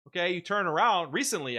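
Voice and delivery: normal talking voice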